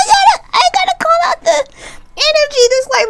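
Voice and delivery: high, fast, laughing through the words. Real, not acted. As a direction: talk while still cracking up.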